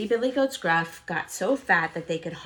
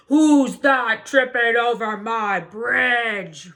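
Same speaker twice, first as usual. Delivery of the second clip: slow, loud troll roar; 4 semitones higher, 9 dB louder, much slower than usual. Acted. Troll roaring at the goat on his bridge, slow and loud. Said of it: "roared the troll".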